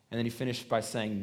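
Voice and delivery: ordinary delivery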